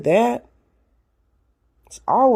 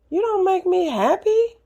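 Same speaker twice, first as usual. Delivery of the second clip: the line pitched way up, rising on "happy"; "You don't make me happy" sung out high and pointed; high emphatic retort; 14 semitones higher than usual. Retold or imitated. Telling her ex at the breakup, high pointed retort. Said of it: "he learned that day".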